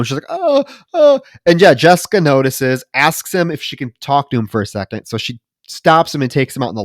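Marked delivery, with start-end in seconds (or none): 0.3-1.2 high-pitched mock moans; imitated moan. Patient who can only moan, mock moaning. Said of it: "like, moan".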